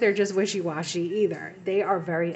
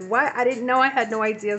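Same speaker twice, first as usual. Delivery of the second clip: higher, louder, excitedly caught off guard; excited surprise; 4 semitones higher, 6 dB louder, faster than usual. Pretend. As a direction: gasp it out, startled and thrilled. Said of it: "stuttering your words out of excitement".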